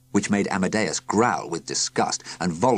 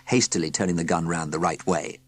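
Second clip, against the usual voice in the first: plain reading voice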